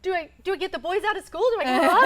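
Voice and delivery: high, loud, flustered rush of questions; panicked husband. Retold or imitated. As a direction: play the frantic husband who doesn't know what to do.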